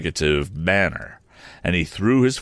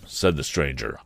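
usual speaking voice

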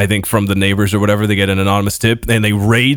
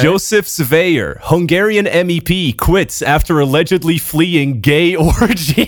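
headline read; 6 semitones higher than usual. Shown as a demonstration. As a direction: read it like a news anchor. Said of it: "read the headline".

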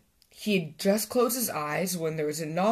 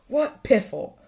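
loud, higher-pitched scoff; grumpy shout; 5 semitones higher, 6 dB louder than usual. A narrator's reading. Badger dismissing Squirrel's Christmas greeting, grumpy shout. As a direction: scoff it out loudly. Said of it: "he shouted back".

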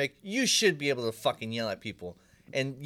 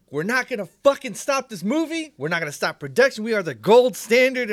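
retold furious rant, pitched way up; angry yelling; 9 semitones higher, 8 dB louder than usual. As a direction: bark it like an angry director on set. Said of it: "he was yelling".

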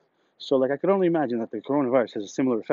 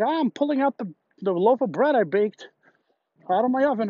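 higher, chirpy mock voice of a proud coworker; 8 semitones higher than usual. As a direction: play the smug baker brightly and higher. Imitating the coworker bragging.

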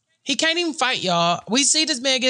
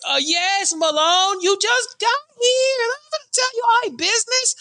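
high, sing-song mock reply; mock her sugary tone; mock sweet; 10 semitones higher than usual. Mocking Jessica's reply.

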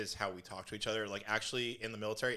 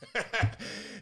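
breathy high burst of laughter; high airy giggle; 11 semitones higher, 4 dB louder than usual. Real, not acted. Laughs at own joke.

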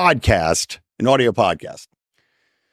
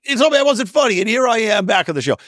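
high, shouted mock taunt at his old bosses; a gloating comeback jab, pitched up and yelled; mocking Norm on air; 12 semitones higher than usual.

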